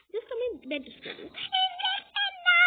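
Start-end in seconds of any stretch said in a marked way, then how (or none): 1.6-2.6 high, loud panicked no; scared scream. Acted. Child screams at the doctor about the injection, terrified. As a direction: shriek in terror of the needle.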